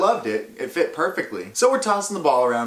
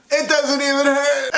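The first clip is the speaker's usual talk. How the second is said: high, strained fake-cool voice; fake brave; 13 semitones higher, 4 dB louder than usual. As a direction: pretend it doesn't hurt, pitched way up. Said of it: "saying all this weird stuff like".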